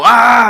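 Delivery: loud, high yell; startling scream. Retold or imitated. Pastor reenacting running out at the kids, loud scream. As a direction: burst out with a sudden scream. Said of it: "they hear me scream".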